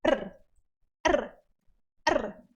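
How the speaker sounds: R, r, r as quick single taps; r tapped three times fast; short tapped r. Shown as a demonstration. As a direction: cut each r short, tongue tap and drop. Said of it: "o mais curto possível".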